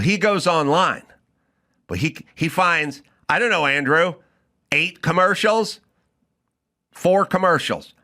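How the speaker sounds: normal talking voice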